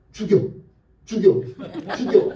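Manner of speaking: imitating a beaten kid's defiant cries; short, repeated mock challenge, played for laughs; stubborn kid's challenge. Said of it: "이러고 달려드는데요".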